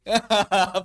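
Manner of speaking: loud burst of laughter; quick hearty laugh; real laugh. Real, not acted. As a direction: laugh at the challenge. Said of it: "Coba baca ijlis tanpa qalqalah".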